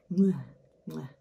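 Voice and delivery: two smacking air kisses at the mirror; kiss sounds. Real, not acted. Makeup artist blowing kisses at her own lip look. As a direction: blow a kiss to show off the lips. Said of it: "shows lip makeup with kisses".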